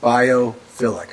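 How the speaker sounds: slow, careful, drawn-out BIOPHILIC; said slowly. Real, not acted. Host carefully sounding out a hard word, slowly. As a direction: take BIOPHILIC slowly, piece by piece. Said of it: "I gotta say it slow".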